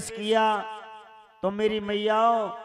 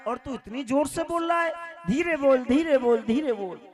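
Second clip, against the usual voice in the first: hushing mother; 5 semitones higher than usual. Acted. Mother hushes loud visitor.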